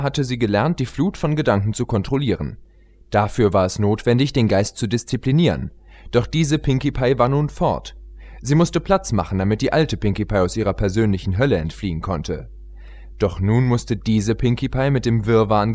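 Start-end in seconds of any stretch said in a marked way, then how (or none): none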